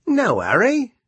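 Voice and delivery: loud, high, cheery carpenter; breezy carpenter. Acted. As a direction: wave them off cheerfully. Carpenter replies.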